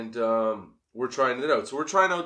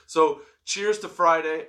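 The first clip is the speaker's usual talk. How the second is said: cheerful toast; 6 semitones higher, 6 dB louder, slower than usual. Real, not acted. Toasting the listeners.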